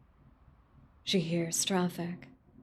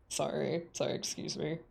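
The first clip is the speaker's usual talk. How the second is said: quiet mumbled apology; 4 semitones lower, 8 dB quieter than usual. Acted.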